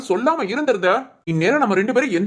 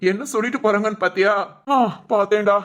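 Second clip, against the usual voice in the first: ordinary talk, nothing marked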